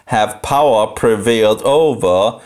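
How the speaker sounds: usual speaking voice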